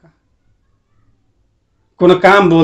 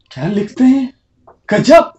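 sarcastic praise; 7 semitones higher than usual. Acted.

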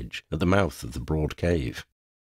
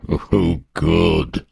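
louder, pleased gloat; gloating dragon; 5 dB louder than usual. A narrator's reading. Dragon gloats at girl's anger, loud pleased gloat. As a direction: relish the reaction. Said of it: "the dragon gloated".

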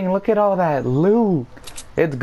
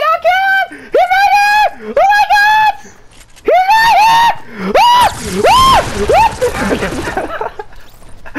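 building to frantic high screams; panicked shouting; 6 dB louder than usual. Real, not acted. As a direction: panic more and more as the enemy closes. Panicked firefight, voice rising.

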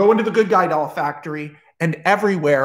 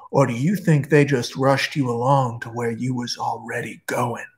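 put-on a creepy old guard, close and menacing; 4 semitones lower than usual. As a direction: lean in low like a haunted old guard. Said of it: "The old man is like".

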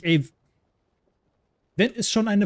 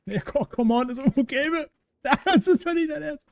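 exasperated laugh; 8 semitones higher than usual. Real, not acted. Can't find ammo, laughs.

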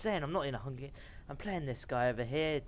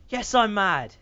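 louder, higher declaration of rage; mock angry shout; 4 semitones higher, 8 dB louder than usual. Real, not acted. Gamer confirming to viewers he's mad, loud mock anger. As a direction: belt it out, hammed-up fury.

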